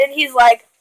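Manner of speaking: plain reading voice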